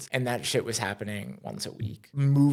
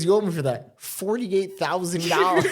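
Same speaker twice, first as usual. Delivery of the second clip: higher, louder, laughing through the mom's joke; laughing retelling; 7 semitones higher, 5 dB louder than usual. Retold or imitated. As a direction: crack up while quoting mom's joke. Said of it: "And then she goes".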